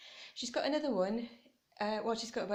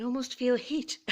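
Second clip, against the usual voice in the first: laughing; 4 semitones higher, 3 dB louder than usual. Real, not acted. Laughs at the bright lights.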